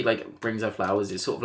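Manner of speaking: ordinary talk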